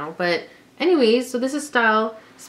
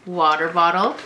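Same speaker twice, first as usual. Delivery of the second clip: normal talking voice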